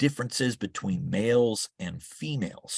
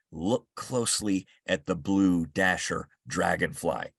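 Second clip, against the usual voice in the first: ordinary delivery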